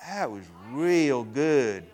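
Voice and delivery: slow, drawn-out Southern praise with a laugh; Alabama churchgoer. Retold or imitated. Preacher imitating his Alabama congregation, slow Southern drawl. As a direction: stretch it out like a Tuscaloosa congregant. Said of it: "they come up afterwards and go".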